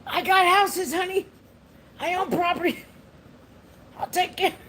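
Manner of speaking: put-on high, loud boastful old man voice; old man bragging. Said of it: "I was like".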